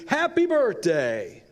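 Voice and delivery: high, slow shouted greeting; retold shout. Diner crowd surprising Agnes at her party, shouting happy birthday. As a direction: belt it out as a surprise cheer. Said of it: "and we all screamed".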